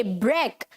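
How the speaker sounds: ब्रेक as low "brek"; short e in ब्रेक, as spelt b-r-e-k; correct first syllable. Shown as a demonstration. Teacher spelling it out, then ब्रेक as "brek". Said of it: "B R E के".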